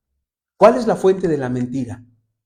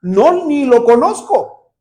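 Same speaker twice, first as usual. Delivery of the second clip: a higher, mock-indignant denial; denying politician; 8 semitones higher, 5 dB louder than usual. Politician denying she knows the man, mock indignant. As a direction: play the politician flatly denying it. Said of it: "Y ella dice".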